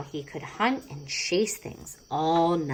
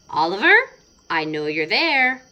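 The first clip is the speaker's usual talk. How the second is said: louder call into the dark garden; 6 semitones higher, 8 dB louder than usual. Acted. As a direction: call the hiding cat in for bed. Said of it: "she called".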